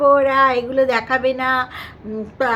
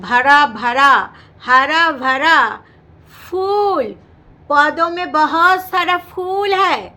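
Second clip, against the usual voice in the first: mock Hindi; 5 dB louder, slower than usual. Imitating the sister's Hindi talk.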